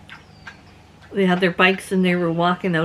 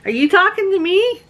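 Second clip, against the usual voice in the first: sassy challenge; 10 semitones higher, 3 dB louder than usual. Retold or imitated. She confronts the rude lady, sassy and high. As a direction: give her attitude right back. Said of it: "I said".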